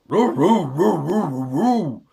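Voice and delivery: loud rolling barks; dog barking. Acted. Bottomley Potts barks back, loud. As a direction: bark like a big dog. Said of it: "said Bottomley Potts".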